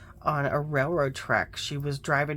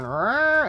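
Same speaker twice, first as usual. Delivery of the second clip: cat growl; 9 semitones higher, 7 dB louder than usual. Retold or imitated. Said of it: "I hear her going".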